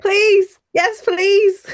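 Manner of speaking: high, loud, eager yes please. Real, not acted. Cutting in gladly on the question, high and loud. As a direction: jump in with delight.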